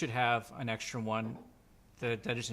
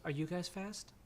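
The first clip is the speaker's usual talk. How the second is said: put-on higher, boyish question; small boy's high voice; young boy; 5 semitones higher, 4 dB quieter than usual. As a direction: ask as a curious little kid. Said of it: "the little kid".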